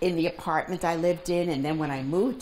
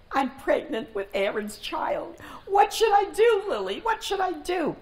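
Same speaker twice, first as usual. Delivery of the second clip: high, loud, desperate imitation of a distraught girl; acted crying plea; 12 semitones higher, 5 dB louder than usual. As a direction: wail it out as a retold cry for help. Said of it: "And she's crying".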